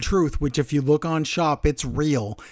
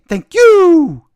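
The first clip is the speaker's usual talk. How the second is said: high, loud catchphrase with drawn-out "you"; Grande; 14 semitones higher, 12 dB louder than usual. Retold or imitated. Imitating a wrestler's old catchphrase, high and loud. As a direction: sing out the catchphrase, falling at the end. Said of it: "the other Grande used to say".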